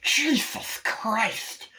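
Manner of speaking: high, slow drawn-out oath; mock startled cry. John Waters turning round, startled mock cry. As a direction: exclaim in exaggerated shock.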